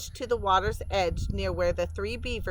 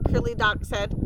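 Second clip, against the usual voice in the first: ordinary delivery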